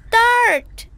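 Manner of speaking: sudden loud high cry. Acted. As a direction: blurt it out in alarm. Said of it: "Peg cried".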